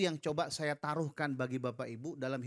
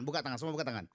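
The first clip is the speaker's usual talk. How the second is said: ordinary talk, nothing marked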